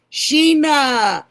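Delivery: calling a name out loud and high, delighted; light up as you spot a friend; a sudden happy shout of a name; excited greeting. Real, not acted. Live stream, greeting arriving viewer.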